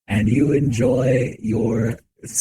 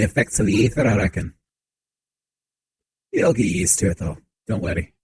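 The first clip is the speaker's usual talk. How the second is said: put-on a light Scottish-Irish brogue for the old man; lightly voiced Scottish-Irish accent. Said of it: "Scottish, Irish, something like that".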